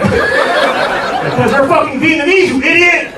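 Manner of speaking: high, loud comic shout. Acted. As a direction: yell it out in exasperation. Yelling back about parents.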